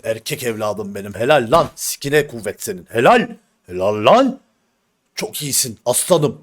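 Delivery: lightly voiced boastful dad; hammed-up proud father praising his son; mock proud father. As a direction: cheer your son like a proud old-fashioned father. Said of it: "diyen aile feritlerine benziyor".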